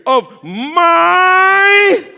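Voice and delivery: high, held scream on "my"; full-force climax of a chant; roof-raising shout. Real, not acted. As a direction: blast it out and hold it long. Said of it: "take this roof right off of this".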